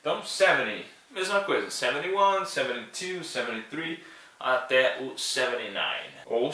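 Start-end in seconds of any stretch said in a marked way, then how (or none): none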